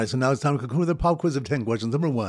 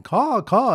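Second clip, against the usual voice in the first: higher caw caw cry; mock bird call; 7 semitones higher, 4 dB louder than usual. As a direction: call out like a crow, playfully. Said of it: "the bird callers weeping and going".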